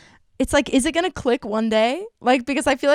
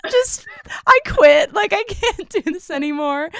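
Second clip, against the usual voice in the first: exasperated outburst; 7 semitones higher than usual. Retold or imitated. Retelling her fed-up reply to the boss, mock exasperated yell. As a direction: throw up your hands and quit, half laughing.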